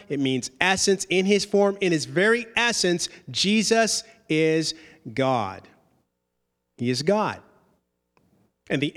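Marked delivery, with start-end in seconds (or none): none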